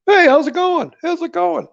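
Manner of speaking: high, loud mimicked greeting; mannish girl imitation. Patient mimics a guy-like girl greeting down the hall, high-pitched imitation. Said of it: "like, a guy, a big fat guy".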